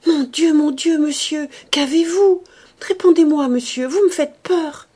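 loud, high, frightened cry. Acted. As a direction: shout for help in terror at his bedside.

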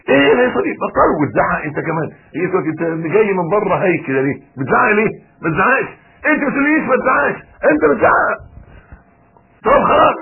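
loud, high-pitched bickering, building to exasperation; acted shouting quarrel. Husband and wife arguing as he walks in, acted angry shouting. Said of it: "عمالة تصرخ وتزعق".

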